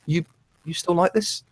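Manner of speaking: a hint of a puzzled old lady; grandma. Grandma asking her grandson if he still likes the show, lightly voiced. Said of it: "my grandma said".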